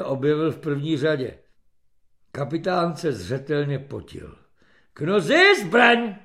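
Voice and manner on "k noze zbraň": raised, drawn-out drill order; loud, high captain's command; military command. A narrator's reading. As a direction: call the order across the ranks.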